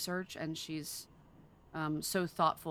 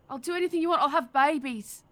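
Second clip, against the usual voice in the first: higher, louder imitation of Kidman with "babies" tripping into an Aussie vowel; Nicole Kidman's American slip; 8 semitones higher, 6 dB louder than usual. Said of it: "the one word that she trips on".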